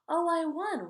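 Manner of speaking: high, loud cry of joy; joyful little victory yell; happy scream. Acted. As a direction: shout it like you just won the race. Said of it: "Riley happily screamed".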